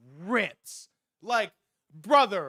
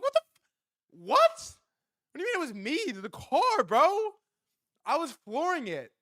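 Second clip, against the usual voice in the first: much higher, squeaky shocked cry; shocked disbelief; 9 semitones higher than usual. Retold or imitated. Driver protests to Malcolm about the car, shocked high pitch. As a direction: react like you can't believe it. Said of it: "I'm like".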